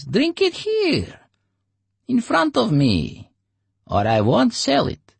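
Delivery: very high, slow insistence; stubborn Oleg. Acted. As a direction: insist on your price.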